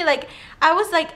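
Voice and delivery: normal talking voice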